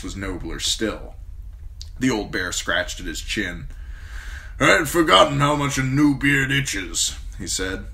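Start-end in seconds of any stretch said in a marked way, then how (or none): 4.6-7.1 old commander voiced higher and louder, blunt and forceful; a gruff old commander praising a young man; gruff old lord commander. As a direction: speak as the stern old Lord Commander, grateful but brusque. Mormont voice.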